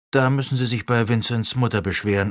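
ordinary delivery

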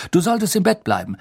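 quick, high, upset scolding; worked up and rushed; agitated. A narrator's reading. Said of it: "sagte Fudge aufgebracht".

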